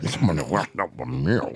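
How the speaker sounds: words squeezed through clenched teeth; low, tight imitation through the teeth; through gritted teeth. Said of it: "he talking through his teeth".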